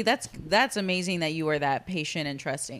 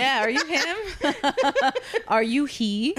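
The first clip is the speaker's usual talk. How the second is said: mock swoon; 9 semitones higher, 5 dB louder, slower than usual. Teasing friend echoes the swoon.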